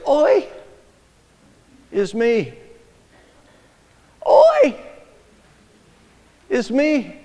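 Oi wailed high, sliding, then is me dropping; wailing oi. Shown as a demonstration. Preacher showing the Hebrew, wailing Oi. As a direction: cry out Oi like a lament. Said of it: "Hebrew literally is".

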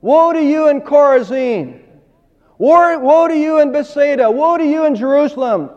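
woe thundered high and loud; preaching woe. Retold or imitated. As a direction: call down woe on the cities. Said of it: "Jesus said".

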